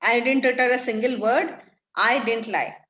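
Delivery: angry denial. Acted. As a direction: hammer home that you never lied.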